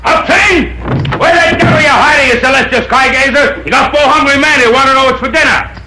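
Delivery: yelling for the cook. Acted. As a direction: bellow for the cook to come out. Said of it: "Why you all the time have to yell?".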